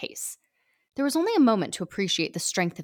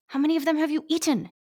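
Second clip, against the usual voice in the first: hissed; 8 semitones higher than usual. Acted. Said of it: "I hissed".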